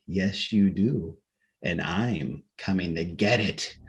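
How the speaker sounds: low, slow, soft ghost voice; ghostly threat. A narrator's reading. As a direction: menace him slowly and low.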